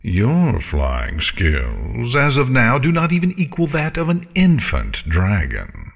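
lightly voiced scornful dragon. A narrator's reading. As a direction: scoff like a haughty dragon. Dragon Scarlet speaks.

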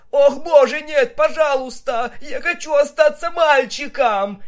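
high, loud, terrified squeal; panicked pleading. A narrator's reading. Captured stallion begging Vinyl not to cut, high panicked squeal. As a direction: beg in a shrill panic for mercy. Captive stallion begs for mercy.

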